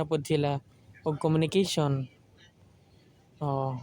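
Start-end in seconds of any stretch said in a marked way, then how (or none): none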